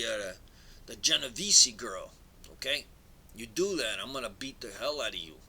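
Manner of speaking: put-on lightly done New York Italian gangster accent; mob boss accent. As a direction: play a Brooklyn mob boss laying down orders. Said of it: "I don't do the accent very well".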